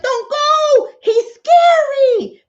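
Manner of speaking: very high, loud mock-frightened plea; hammed-up high wail of warning; scared sheep. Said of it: "wolf was scary".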